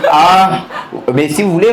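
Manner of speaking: ordinary talk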